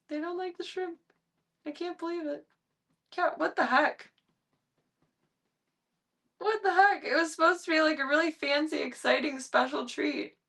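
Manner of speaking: can't believe it, voice climbing high; high disbelief. Real, not acted. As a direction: owner baffled her cats refuse the treat, high and whiny. Disbelief at cats refusing.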